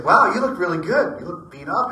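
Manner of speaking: excited compliment. Retold or imitated. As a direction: gush over his uniform. Praising a reenactor's kit.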